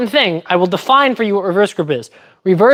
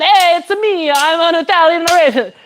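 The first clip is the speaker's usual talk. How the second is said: much higher, hammed-up Italian accent; mock Italian accent; 9 semitones higher than usual. Streamer playing an Italian rapier, comic Italian accent. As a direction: announce yourself as a cartoonish high Italian. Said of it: "I should be Italian".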